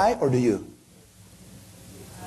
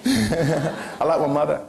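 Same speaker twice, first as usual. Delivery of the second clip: laughing; 2 semitones lower, much slower than usual. Real, not acted. Answering own question, laughing.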